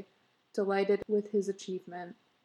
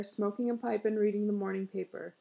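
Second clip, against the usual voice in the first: usual speaking voice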